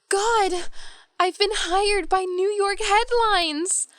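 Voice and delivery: high-pitched, drawn-out joyful announcement; gasp, then cry out the amazing news; overjoyed, voice soaring; thrilled disbelief. Acted. Reads job offer in email.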